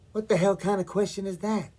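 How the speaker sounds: baffled outburst, pitched well up; exasperated. Acted. Caterer questioning a line of Hamlet, exasperated.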